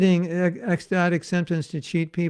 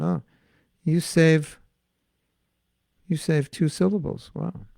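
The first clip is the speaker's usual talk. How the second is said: ordinary delivery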